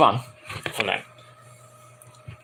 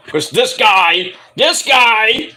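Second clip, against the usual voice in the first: louder, drawn-out mock blame; mock accusing; 5 semitones higher, 4 dB louder, much slower than usual. Singer blaming the fan in mock outrage. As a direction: point him out teasingly, stretch it. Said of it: "And Ash is like".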